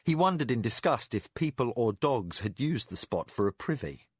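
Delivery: ordinary delivery, nothing marked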